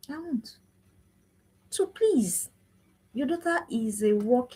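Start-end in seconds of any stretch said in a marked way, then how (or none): 0.1-0.6 much softer, almost murmured I won't; quiet. Real, not acted. Speaker repeating her vow to mothers, fading soft. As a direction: let it fade to a near hush.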